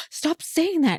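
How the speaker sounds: high-pitched mock horror; retold shocked protest. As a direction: act out being scandalized, pitch jumping up. Retold shock at blunt word.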